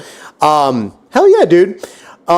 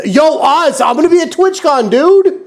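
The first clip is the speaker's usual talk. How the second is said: much higher, excited shout-out to Oz; excited call; 13 semitones higher than usual. Real, not acted. Streamer hyping his friend about TwitchCon, high excited call. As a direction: pitch it way up, hyped.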